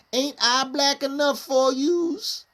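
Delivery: high, slow, drawn-out poetic delivery, rising at the end; reciting own poem. Retold or imitated. Correcting himself while quoting his poem, high slow recital voice. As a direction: stretch it out high like spoken-word verse. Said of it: "No, I was like".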